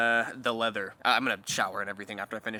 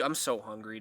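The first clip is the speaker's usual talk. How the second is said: usual speaking voice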